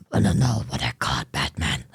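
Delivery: very low, throaty superhero rasp; Batman. Acted. Streamer taunting Batman as Joker, deep raspy growl. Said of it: "That's Batman's voice, not Joker's".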